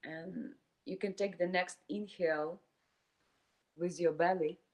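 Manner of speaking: calm meditation voice with long silent pauses; guided meditation. Acted. Teacher leading a group through inhale and exhale, guided meditation. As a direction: guide them breath by breath, leaving space between phrases.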